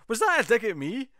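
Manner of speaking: high-pitched mock indignation; indignant comeback. Bumblebee challenges Malcolm mid-mission over his digs, mock indignant. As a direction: act out an affronted challenge, pitched well up.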